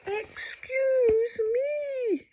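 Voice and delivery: high, slow drawn-out cry; startled hippo. Acted. Moon hippo startled at being landed on, high. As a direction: huff in surprise.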